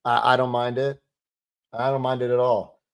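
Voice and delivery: normal talking voice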